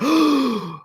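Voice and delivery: sharp high gasp of shock. Retold or imitated. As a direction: gasp like the news floors you. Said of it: "Guys are, like, shocked".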